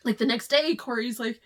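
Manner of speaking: ordinary delivery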